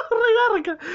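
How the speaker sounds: high, loud jab still laughing. Real, not acted. Reader mocking the doctor while laughing, high and loud. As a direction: blurt it out mid-laugh.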